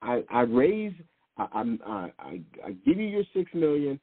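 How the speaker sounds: ordinary delivery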